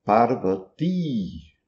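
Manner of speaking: "Parvati" slow, final I drawn out; Sanskrit long I. Shown as a demonstration. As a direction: stretch the "ti" of "Parvati". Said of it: "a pronúncia correta em sânscrito". ("Parvati" com I longo no fim)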